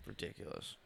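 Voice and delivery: breathy whispered aside. Real, not acted. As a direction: whisper it in disbelief. Disgusted aside.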